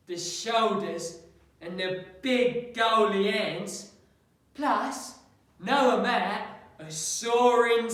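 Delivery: Patsy the storyteller, building from matter-of-fact to emphatic. Acted. Patsy professing manly love to Mister West, emphatic in character.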